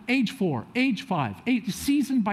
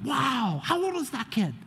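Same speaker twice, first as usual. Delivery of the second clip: high-pitched mock amazement; wowed, voice lifted high; amazed onlooker; 7 semitones higher, 3 dB quieter than usual. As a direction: imitate an astonished onlooker. Onlookers calling from the ski lift.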